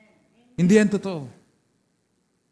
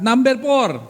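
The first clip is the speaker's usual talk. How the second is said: rallying call; 3 semitones higher, 4 dB louder, slower than usual. Real, not acted. Preacher counts congregation in to read point four, rallying call. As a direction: call out the number and count them in.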